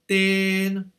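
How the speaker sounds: Ten held high and flat like a sung la; flat musical tone. Shown as a demonstration. Teacher shows the level tone of Ten to students. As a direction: sing Ten on one high level note. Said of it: "c'est plat et musical".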